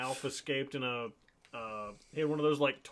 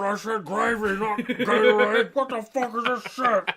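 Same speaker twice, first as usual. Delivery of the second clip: manatee voice; 9 semitones higher, 11 dB louder than usual. Retold or imitated. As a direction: yell like an outraged manatee. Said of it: "I just gave him Brett Keane manatee voice".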